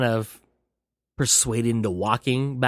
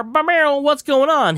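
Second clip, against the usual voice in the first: car zoom noise; 14 semitones higher, 4 dB louder than usual. Retold or imitated. Car arrives with sound effect.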